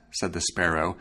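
plain reading voice

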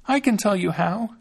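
put-on high, small voice of a thumb-sized boy; little Thumbling, fretful; Thumbling's tiny voice. A narrator's reading. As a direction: pitch it up like a tiny hero.

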